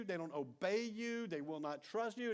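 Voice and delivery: ordinary talk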